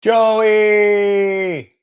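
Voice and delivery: high, loud drawn-out hail; long excited shout of greeting; calling out. Real, not acted. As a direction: holler the name and hold the last vowel. Calls out greeting to Joey.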